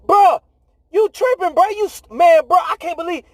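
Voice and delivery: high, incredulous mock voice of the disciples; hammed-up high disbelieving disciples; disciples in disbelief. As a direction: squeak out the disbelief like a stunned friend. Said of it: "And they were like".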